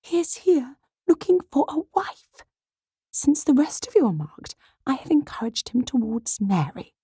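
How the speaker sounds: high, breathy stage whisper; urgent, high-pitched conspiratorial half-whisper; hushed voice. Acted. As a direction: confide a scheme to your daughters, hushed. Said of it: "spoke in a hushed voice".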